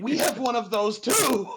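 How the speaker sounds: high, loud eager shout; excited exclaim. Acted. Player's Eldrick answering the mayor, excitedly shouting. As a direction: blurt it out delighted. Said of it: "Eldrick looks at him and goes".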